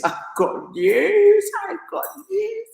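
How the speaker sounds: high, drawn-out sing-song squeal; gleeful teasing. Real, not acted. Teasing viewers with news.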